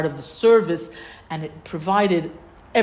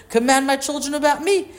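raised pitch, emphatic acted reply; insistent counter-demand; 8 semitones higher, 3 dB louder than usual. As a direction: turn the demand back on the listener. Same acted reply, to Moshe.

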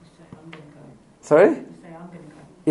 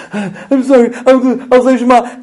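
put-on gasping, high, louder pleading of a sobbing little boy; sobbing child; 7 semitones higher, 7 dB louder, slower than usual. Son after his tantrum, gasping sorry through tears. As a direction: gulp for air and beg like a crying child.

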